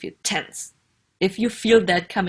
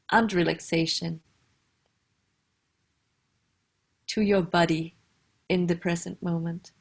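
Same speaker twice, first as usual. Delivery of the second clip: guided meditation. Acted. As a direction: ease the listener into a comfortable posture, unhurried.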